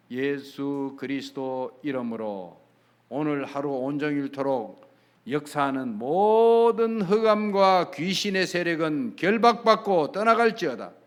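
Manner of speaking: slow prayer building to a loud, commanding close; demonstrated prayer. Shown as a demonstration. Preacher showing a morning prayer against evil spirits, commanding prayer. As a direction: start measured, then swell into a forceful banishing command. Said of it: "한 분만 기도하세요".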